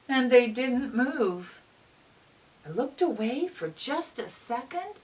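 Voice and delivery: bright, lively read-aloud voice; reading to children. A narrator's reading. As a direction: read it like storytime for little kids. Reads picture book aloud.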